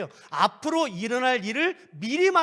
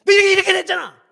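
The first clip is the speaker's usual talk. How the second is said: shouted, much higher and louder; furious rebuke; 9 semitones higher, 11 dB louder, faster than usual. Acted. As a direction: yell at them to come here. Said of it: "예언의 은사가 제일 잘하는 게 책망".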